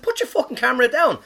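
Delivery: high, loud exasperated command; retold angry order. Comic losing it at girls filming him, angrily retold. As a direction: raise your voice, temper gone.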